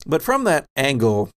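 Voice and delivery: usual speaking voice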